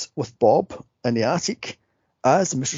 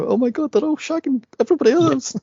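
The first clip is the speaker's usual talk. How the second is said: high-pitched scandalized exclamation; playful appalled outburst; mock shock; 12 semitones higher, 3 dB louder than usual. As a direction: react in pretend horror, pitched way up. Acting shock at the picnic chaos.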